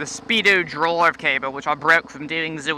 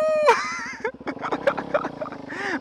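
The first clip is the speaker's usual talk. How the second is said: mock wail; 8 dB quieter than usual. After mock panic.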